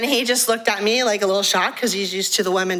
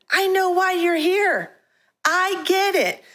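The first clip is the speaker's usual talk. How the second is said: higher, slow and tender, like reaching out to a lost man; gentle plea; 8 semitones higher, slower than usual. Retold or imitated. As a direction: soften it and slow right down, coaxing. Reformed pimp pleads with men.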